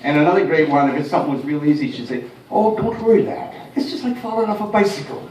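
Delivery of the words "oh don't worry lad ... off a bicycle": higher Irish brogue; a lilting Irish mother comforting her lad; gentle Irish mum brogue. Retold or imitated. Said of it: "with an Irish brogue".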